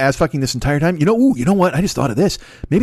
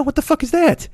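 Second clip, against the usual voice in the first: incredulous; 13 semitones higher than usual. Real, not acted. Incredulous outburst.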